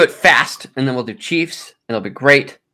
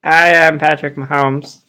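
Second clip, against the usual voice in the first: Patrick Mahomes impression; 3 semitones higher than usual. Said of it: "apparently a Southern accent".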